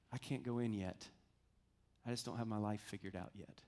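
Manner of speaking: low, soft, hesitant reply; subdued refusal. Retold or imitated. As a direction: imitate a man quietly declining, with pauses. Guard's reluctant refusal, retold.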